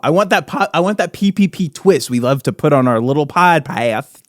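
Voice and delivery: ordinary talk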